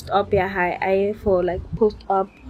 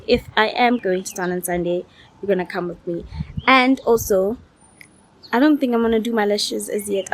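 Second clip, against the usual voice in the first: ordinary talk, nothing marked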